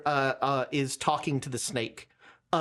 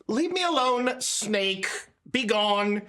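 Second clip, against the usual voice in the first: lightly acted imperious dismissal; dismissive ambassador; 4 semitones higher, slower than usual. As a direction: send the snake away, voice lifted a little. Game master voices ambassador dismissing snake.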